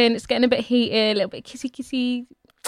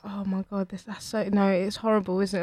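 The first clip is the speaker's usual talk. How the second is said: quiet embarrassment; 2 semitones lower, 8 dB quieter than usual. Retold or imitated. Retelling Chloe's embarrassed reply.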